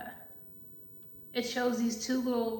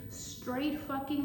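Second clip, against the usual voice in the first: ordinary delivery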